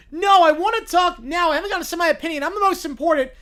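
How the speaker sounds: put-on loud, childish, insistent demand; whiny kid yell. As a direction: shout to get your turn. Kid demanding a turn in court.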